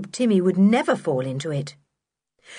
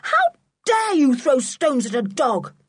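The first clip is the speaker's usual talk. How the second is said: put-on high, outraged little-girl yell; furious yell; 7 semitones higher, 3 dB louder than usual. A narrator's reading. George rebuking Block for threatening Timmy, furious. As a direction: yell it in a fury. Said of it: "yelled the little girl in a fury".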